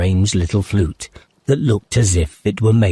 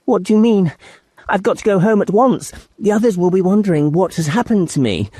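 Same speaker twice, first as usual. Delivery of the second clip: put-on high, hurried girl voice; a worried girl wanting to leave; fast, high, anxious young girl; anxious Lucy; 11 semitones higher, faster than usual. A narrator's reading. Lucy frightened.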